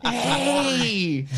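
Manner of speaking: put-on high, drawn-out silly drunk voice; little Nicky. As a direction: imitate a goofy character's greeting. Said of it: "Why do you turn into little Nicky".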